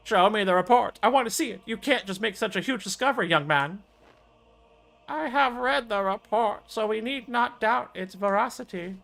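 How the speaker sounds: high, eager elder voice; elder character voice. Acted. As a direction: press hard to see the report.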